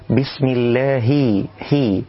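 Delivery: الله with the h ending in a short i, slow; clear kasra on ha. Shown as a demonstration. Teacher shows the correct ha. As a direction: give the ha of الله a clean i. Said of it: "تحقيق الكسر في الهاء".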